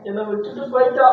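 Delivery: wailing, high and loud through tears; loud crying. Acted. Husband wailing to wife over lost girlfriend, loud crying. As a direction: bawl it out like a heartbroken man. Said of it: "ஏன் தனியா அழுதுகிட்டு இருக்க?".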